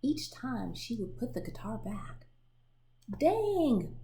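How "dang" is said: high, loud exasperated Dang; exclaimed dang. Acted. Claude reacting to his broken guitar, exasperated. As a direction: blurt it out in annoyance. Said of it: "Claude said".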